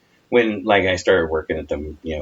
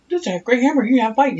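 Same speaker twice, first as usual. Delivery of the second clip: much higher, louder mock yell; 4 dB louder, faster than usual. Imitating mom yelling in her sleep, higher and louder. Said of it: "she yelled".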